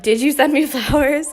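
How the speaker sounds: giggling through the question; mock caps message, laughing; laughing quote. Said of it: "a message that was like".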